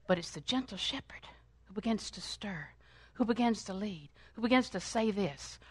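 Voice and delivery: soft whisper about the shepherd. Acted. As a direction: draw them in with a whisper. Sudden hush in sermon.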